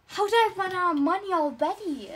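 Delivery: loud fast disbelief; shocked. Acted. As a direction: blurt out in disbelief. Discovers no money.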